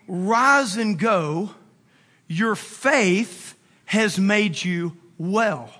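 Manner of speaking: slow and solemn. Acted. Jesus to the healed leper, slow and solemn. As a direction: draw out each phrase with long pauses.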